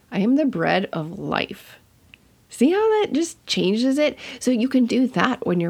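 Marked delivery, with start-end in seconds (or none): 0.1-1.8 "life" held back, then leaned on at the end; stress on LIFE. Shown as a demonstration. Teacher showing how emphasis changes a verse, stressing "life". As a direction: land the weight on the last word, "life". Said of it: "emphasize one different word each time".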